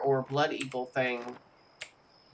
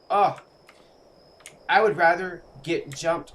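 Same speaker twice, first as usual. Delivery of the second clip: ordinary talk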